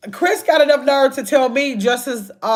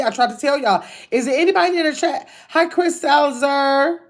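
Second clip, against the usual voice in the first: ordinary talk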